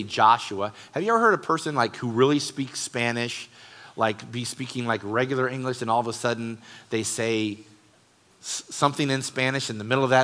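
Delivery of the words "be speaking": ordinary talk, nothing marked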